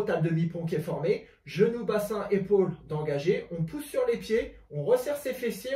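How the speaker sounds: ordinary talk, nothing marked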